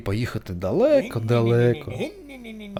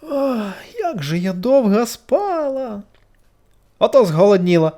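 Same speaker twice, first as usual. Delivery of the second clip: high, slow, dreamy then louder; waking butterfly; 10 semitones higher, 5 dB louder, slower than usual. A narrator's reading. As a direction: stretch the words as you wake. (метелик щойно прокинувся)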